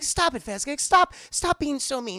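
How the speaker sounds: high, loud, sharp scolding. Retold or imitated. Kira chastises Fizzgig for snapping at Jen, high loud scolding. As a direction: scold a naughty pet.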